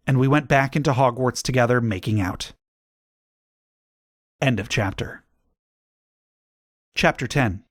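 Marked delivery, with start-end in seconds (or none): none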